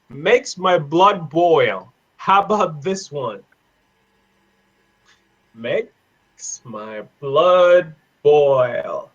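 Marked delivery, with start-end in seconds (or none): 5.6-9.1 very slow, drawn-out words; word by word, extremely slowly; slow dictation. Shown as a demonstration. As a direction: stretch out each word as if dictating.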